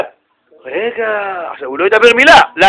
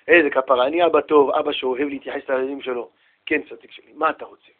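low, soft affectionate voice; patient father; 6 semitones lower, 8 dB quieter than usual. Acted. Father attends to his child with endearments, patiently. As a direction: soothe the interrupting child.

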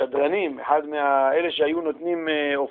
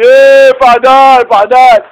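loud jeering taunt, higher and louder; soldiers jeering; 7 semitones higher, 13 dB louder, much slower than usual. Retold or imitated. Soldiers taunting the deserter, jeering loudly. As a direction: yell coward at him across the line. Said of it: "צועקים לו חיילים".

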